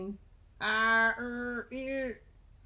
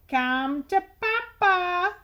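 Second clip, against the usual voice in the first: loud, high, slow greedy call; shouted; 7 semitones higher, 5 dB louder, slower than usual. Acted. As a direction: beckon the cookies in like a hungry boss. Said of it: "shouted the tooth".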